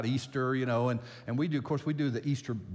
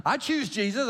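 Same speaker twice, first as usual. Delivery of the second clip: higher, louder imitation of a child; eager child; 10 semitones higher, 6 dB louder than usual. Small child telling grandpa whom they follow, eager and emphatic. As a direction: pipe up proudly like a little kid.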